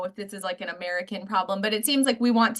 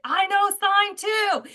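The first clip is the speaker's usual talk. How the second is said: high, slow playful put-on voice; high, slow mock-sweet reply; mock gushing reply; 10 semitones higher, slower than usual. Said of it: "and I was like".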